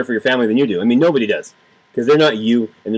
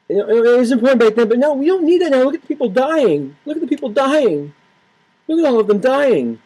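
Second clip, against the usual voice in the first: high, whiny mock liberal pleading; 10 semitones higher than usual. Mocking gun-control liberals in a high whine. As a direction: sneer it up high like a hand-wringing liberal.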